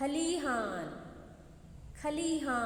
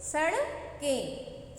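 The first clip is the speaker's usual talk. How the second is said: सड़कें drawn out slowly with a clear retroflex "ड़"; teacher modelling सड़कें slowly for repetition; slow model word; 4 semitones higher, much slower than usual. Shown as a demonstration. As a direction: stretch सड़कें so the children hear every sound. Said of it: "क्या बोलते हैं?".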